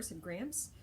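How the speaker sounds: ordinary delivery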